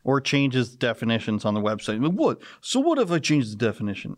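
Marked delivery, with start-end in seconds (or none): 2.1-4.2 put-on lightly done New York accent; a hint of a New York politician. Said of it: "I'm working on my New York accent".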